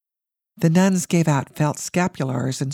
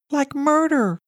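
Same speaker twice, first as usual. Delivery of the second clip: high, lightly voiced girl; child's voice; 11 semitones higher than usual. A narrator's reading. Girl adding to her protest to her mother, high and anxious. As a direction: add it on like an anxious kid.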